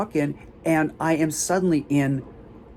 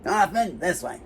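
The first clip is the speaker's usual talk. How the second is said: higher, put-on guard voice, repeated like a relay; disgruntled security guards; 5 semitones higher than usual. One security person after another points the way, disgruntled imitation. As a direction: imitate bored-of-it guards calling out directions in a raised pitch. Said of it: "one disgruntled security person after another".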